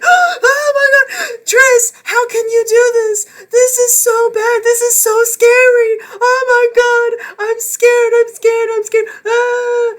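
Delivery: very high, very loud hammed-up shrieking sobs; mock panic attack. Reviewer mocking the panicking instructor, hammed-up shrieking sobs. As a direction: wail and sob like a terrified man, over the top. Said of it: "having a full-on panic attack and be like".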